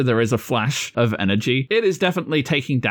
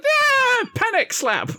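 high squeaky panic yelp; panicking little lizard; slower than usual. Acted. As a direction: yelp in a flailing creature's voice. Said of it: "He's just like".

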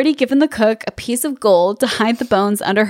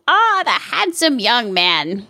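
witch voice; slower than usual. Acted. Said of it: "cries Bony Legged".

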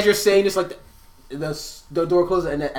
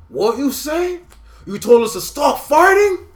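high, loud, offended orc; a brawling orc taking offence; 10 semitones higher, 7 dB louder than usual. Acted. Orc voiced by game master.

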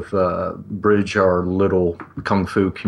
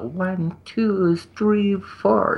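put-on old dancers counting; 11 semitones higher, much slower than usual. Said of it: "and they're like".

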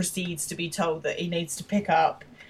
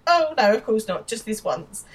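higher-pitched, obliging retold reply; oblivious agreement; 4 semitones higher, 5 dB louder than usual. Will missing Jim's sarcasm, obliviously agreeable. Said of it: "doesn't get the sarcasm".

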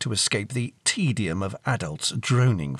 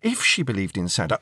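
ordinary delivery, nothing marked

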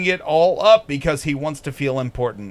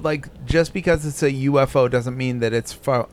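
ordinary talk

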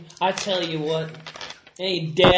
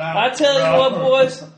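put-on high, loud good-ol'-boy call; rally the boys; lightly voiced good ol' boy; folksy character; 7 semitones higher, 8 dB louder, slower than usual.

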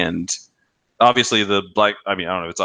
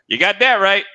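higher, punchy imitated old man; old man's catchphrase; 11 semitones higher, 4 dB louder than usual. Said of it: "And the old man says".

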